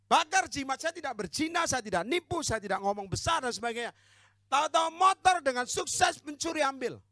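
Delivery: high, loud testimony from the pulpit; emphatic preacher's protest; full-force preaching. Real, not acted. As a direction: pile it up, building to a loud finish.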